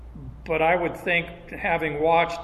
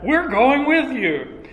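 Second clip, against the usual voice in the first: higher, slower, every word leaned on; emphatic; 8 semitones higher, 4 dB louder, slower than usual. Acted. Disciples insist to Peter they're coming, slow and emphatic. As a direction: stretch it out, insisting.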